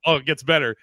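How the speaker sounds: ordinary delivery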